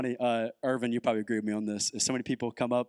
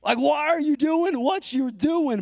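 higher, slow, pleading cry to God; exasperated Elijah; 14 semitones higher, 5 dB louder, much slower than usual. Retold or imitated. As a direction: ask God why like a bewildered prophet. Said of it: "Elijah's like".

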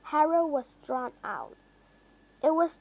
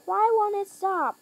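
high, louder panicked question; alarmed; 7 semitones higher, 5 dB louder than usual. A narrator's reading. Harold watching the plane head for the farm, alarmed. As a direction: panic as it won't stop.

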